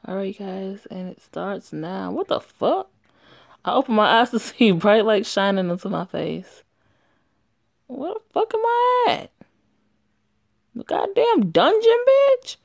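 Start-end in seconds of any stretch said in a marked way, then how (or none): 2.1-2.9 high, startled what the fuck; higher voiced character snapping awake; shocked outburst. Character wakes up confused. 7.9-12.6 high-pitched, indignant character voice; confused high voice. Acted. Character waking in strange place.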